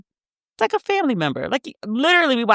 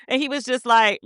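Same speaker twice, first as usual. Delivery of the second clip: normal talking voice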